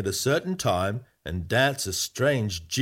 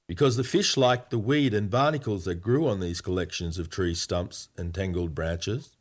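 usual speaking voice

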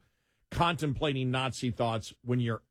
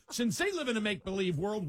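normal talking voice

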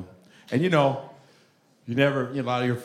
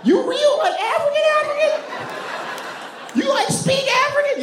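shrieky falsetto, way up high; very high, incredulous mock Southern voice; high-pitched Southern surprise. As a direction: squeal it like a stunned Southerner. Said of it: "their voices go up really high".

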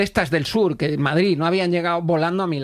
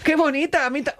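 high, forced delight, faking interest; fake enthusiasm; 10 semitones higher than usual. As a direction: pretend to love the bug to save the date. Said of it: "empecé a decir".

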